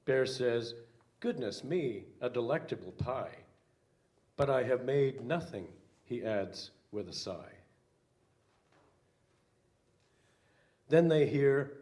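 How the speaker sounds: put-on lively read-aloud for children; storybook reading. A narrator's reading. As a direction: read it like a picture book to kids. Pastor reads children's picture book.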